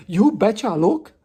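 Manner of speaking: loud, high mock call-out; imitated loud reply. Coworker calling from the desk, imitated loud reply. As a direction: boom it out like the coworker.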